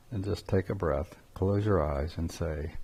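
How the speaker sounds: very soft, settling voice; hushed, calming guidance. Shown as a demonstration. Teacher tells listeners to close their eyes, hushed and calming. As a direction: let it drop to a quiet, reflective close. Guides listeners into a quiet breath.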